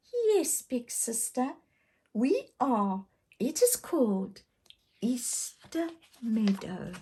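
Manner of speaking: put-on high little girl, calm and easy; relaxed. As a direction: answer lightly as the relaxed little sister. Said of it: "in a relaxed voice replied".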